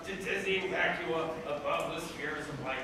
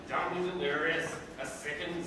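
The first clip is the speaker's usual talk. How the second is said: ordinary talk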